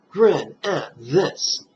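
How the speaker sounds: slow, drawn-out grin at this; unhurried teaching demo; slow demo recitation. Shown as a demonstration. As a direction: say it slowly, like an inner recitation. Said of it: "what do you mentally recite".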